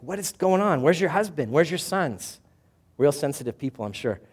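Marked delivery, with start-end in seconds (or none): 0.0-2.4 loud, high, incredulous questions; nosy townsfolk. Retold or imitated. Townspeople questioning returning Naomi, loud and incredulous. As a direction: gawk at her like gossiping neighbours. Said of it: "everybody's like".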